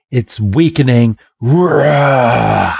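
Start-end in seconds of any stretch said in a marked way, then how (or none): 1.4-2.8 drawn-out imitated roar; acted creature cry; monster roar. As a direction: roar like a wounded beast. Imitates agitated Kangaskhan.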